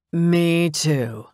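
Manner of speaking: drawn-out, teasing lilt; playful lilt. A narrator's reading. Derek agrees with the widow, in a playful lilt. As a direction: agree playfully, letting it glide down. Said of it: "said with a playful lilt".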